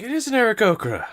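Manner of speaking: high, loud, fast cry with the stress on "is"; excited crowd voice. Acted. Tavern crowd confirming the birdfolk is real, squealing. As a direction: squeal it like a thrilled fan.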